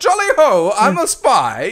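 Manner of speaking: mock upper-class Brit, pitched high; hammed-up jolly British toff; posh British spy. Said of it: "they're like".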